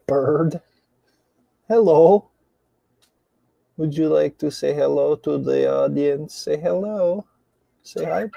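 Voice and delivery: high sing-song pet voice; teaching a parrot. Real, not acted. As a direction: coax the bird to talk, high and bright. Talking to the parrot.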